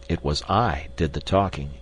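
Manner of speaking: ordinary delivery, nothing marked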